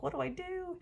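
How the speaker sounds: high-pitched mock fretting; stressed. Fan torn over which photo card to keep, stressed. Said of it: "I was really stressed about it".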